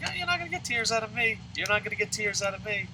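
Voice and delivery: high-pitched retold protest; mock defiance. Retold defiance before crying.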